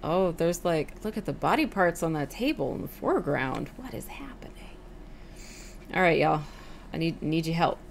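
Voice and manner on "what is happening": breathy whisper of alarm. Real, not acted. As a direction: whisper it in disbelief. Whispered aside at body parts.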